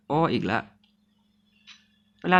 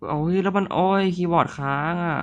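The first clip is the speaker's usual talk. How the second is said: higher, louder exasperated oh no; annoyed wail at a stuck keyboard; frustrated groan; 7 semitones higher, 4 dB louder than usual. Real, not acted. Gamer whose keyboard freezes, groaning higher and louder. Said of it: "ปุ่มกดมันป่วย".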